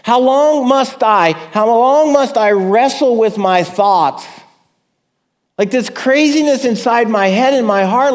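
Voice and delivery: high, loud anguished questioning; desperate plea. Acted. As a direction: keep pleading, raw and urgent. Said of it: "Can you feel the desperation?".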